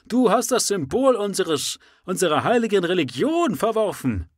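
high, loud accusing cry; shouting. Acted. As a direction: hurl the accusation. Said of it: "schrie der Anhänger".

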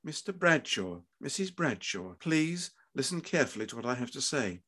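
soft, careful delivery; grave doctor. A narrator's reading. Surgeon preparing parents for bad news, grave and soft. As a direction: ask for attention quietly and gently.

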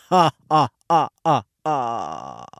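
ha-ha-ha like a cartoon count; count laugh. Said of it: "the Count from Sesame Street".

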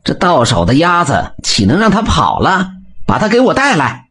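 high, gloating villain's command; smug order. A narrator's reading. Young master smugly orders his servants, gloating high. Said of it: "得意地说道".

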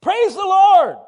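fierce high-pitched church command; insistent shout. Retold or imitated. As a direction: holler it right at her. Preacher badgering frail woman to praise.

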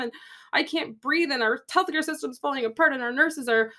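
high-pitched whining, crying to husband; whiny rant. Retold or imitated. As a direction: retell a tearful rant in one long whiny run-on. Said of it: "into one whiny sentence".

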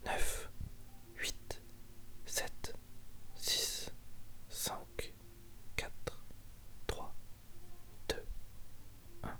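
soft, unvoiced whisper counting down; whispered pause. Host whispering a break to listeners, hushed countdown. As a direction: hush the listeners like an ASMR break.

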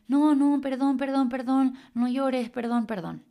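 high, loud flustered pleading, slowing on no llores; panicked apology. Retold or imitated. Someone who hurt her pleads, in a flustered high apology. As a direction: fret over the crying person and beg forgiveness. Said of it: "la reacción de la otra persona".

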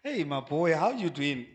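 low, easy greeting to a son; relaxed fatherly hello; friendly parent. Shown as a demonstration. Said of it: "because my voice hasn't".

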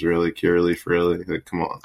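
ordinary talk, nothing marked

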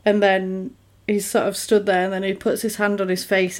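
ordinary talk, nothing marked

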